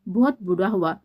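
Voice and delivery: plain reading voice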